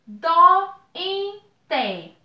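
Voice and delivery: "Dó, em, té" dito em sílabas destacadas; sílabas separadas. Shown as a demonstration. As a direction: marque cada sílaba de "Dó, em, té". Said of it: "Duas vogais em sílabas separadas". (sílabas separadas com pausa)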